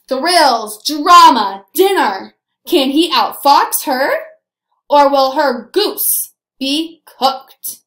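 punchy, loud key words with slow, suspenseful questions; dramatic blurb reading. A narrator's reading. Teacher reads the back cover to scholars, loud and dramatic. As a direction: read it like a dramatic teaser.

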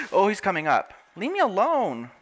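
high, loud scared plea; panicked. Real, not acted. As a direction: back away from the monster in panic. Drowned rises from the water.

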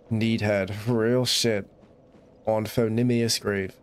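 usual speaking voice

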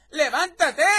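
loud, high shouted command; wake-up shout. Acted. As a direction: shout the sleeper awake. Voices character waking a sleeper. (grito para despertar a alguien)

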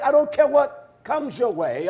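ordinary talk, nothing marked

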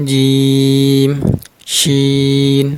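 জিম and শিন each stretched out long and even; demonstrated letter names. Shown as a demonstration. Teacher drawing out letter names for Quran students. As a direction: hold every letter name slow and steady. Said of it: "লাগিয়ে উচ্চারণ করতে হবে".

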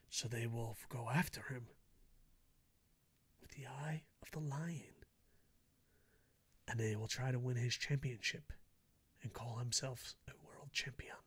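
fading down to a hushed whisper; mock epic whisper. Fan spinning a mock legend about one super belt, whispered.

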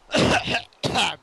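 coughing fit after laughing; loud rough cough; real cough. Real, not acted. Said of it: "I was actually coughing".

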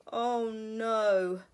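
loud, despairing groan; weary bear. Acted. Mister Bear's weary complaint.

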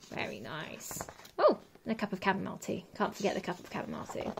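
quick whispered remark; whispered aside. Real, not acted. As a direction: whisper it to yourself. Reader's whispered aside on picture.

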